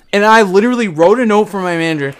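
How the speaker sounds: usual speaking voice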